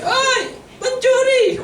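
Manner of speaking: very high, slow hammed-up shout; calling out to wife. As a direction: bellow it across the church like a joke. (suami memanggil istrinya di gereja, teriakan tinggi dan lambat)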